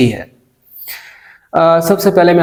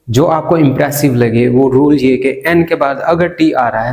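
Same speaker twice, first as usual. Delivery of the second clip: normal talking voice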